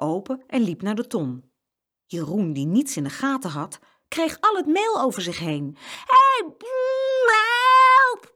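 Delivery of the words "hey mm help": loud, much higher yell; bellowing for help. Acted. Jeroen covered in flour, loud startled yell. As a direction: yell as flour pours over you. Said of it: "brulde hij".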